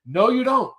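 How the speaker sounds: high-pitched retold refusal; mock alarmed no; firm refusal. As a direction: cut the offer off. Acting his refusal of a kiss.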